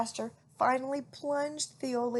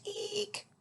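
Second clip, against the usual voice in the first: screech; 12 semitones higher, 3 dB quieter than usual. A narrator's reading. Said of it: "he screeched".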